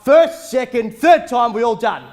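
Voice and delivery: loud final call. Acted. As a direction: belt out first, second, third. Auctioneer final calls.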